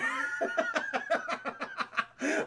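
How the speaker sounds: high squealing laugh; real laughing fit. Real, not acted. Streamer cracking up at his own retelling, squealing laugh. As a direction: crack up in a long high cackle.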